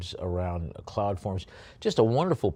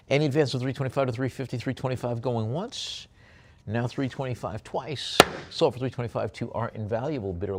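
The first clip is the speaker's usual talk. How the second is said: brisk, higher auctioneer chant; auctioneer calling bids; 4 semitones higher than usual. Acted. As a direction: rattle off the bids to sell the lot.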